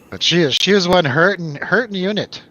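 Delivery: ordinary delivery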